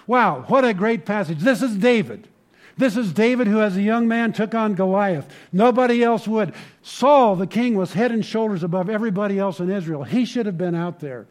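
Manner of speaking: loud, high, impassioned preaching; fervent sermon climax; full-force preaching. Acted. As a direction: preach it out to the back row.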